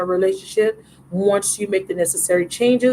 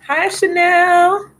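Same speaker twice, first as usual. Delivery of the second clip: high, slow sing-song hello; excited drawn-out greeting; 9 semitones higher, 3 dB louder, much slower than usual. Real, not acted. Greeting a viewer who just joined the live, high and drawn out. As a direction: stretch the name out brightly.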